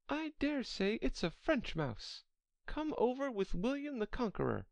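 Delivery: high-pitched Alice voice, wondering; Alice pondering. Acted.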